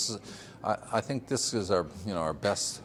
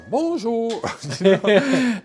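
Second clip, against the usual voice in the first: a higher, louder put-on French Bonjour; mock French greeting; 10 dB louder, much slower than usual. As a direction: sing out Bonjour like a Frenchman on the phone. French maker calls on the phone.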